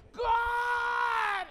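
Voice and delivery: high drawn-out whine to God; whiny cry. Retold or imitated. Preacher lying on bed calling God, whiny. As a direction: wail his name like a sulky kid.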